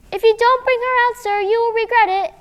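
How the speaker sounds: high and loud warning; girl calls a brave warning to the dragon; firm call. Acted. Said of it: "called fourth sister".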